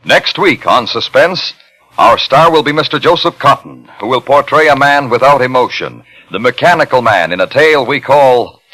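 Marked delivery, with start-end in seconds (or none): none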